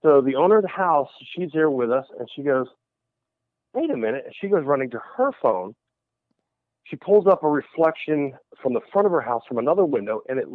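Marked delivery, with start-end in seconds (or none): 3.7-4.3 sudden realization. Retold or imitated. Retold owner's sudden realization.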